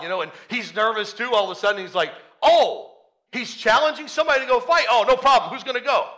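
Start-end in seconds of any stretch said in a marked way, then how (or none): 2.4-6.1 higher, keyed-up eagerness; eager. Acted. David realizing there's a challenge, eager. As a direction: jump at the challenge.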